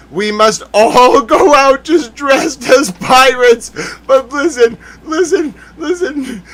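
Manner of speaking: high voice cracking into laughter; helpless giggly plea for attention; laughing. Real, not acted. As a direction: try to press on while laughing. Breaking into laughter at own bit.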